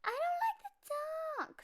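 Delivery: high, small scared cub voice; a frightened little cub, voice way up high; little bear voice. Acted. Cub voicing fear of dark.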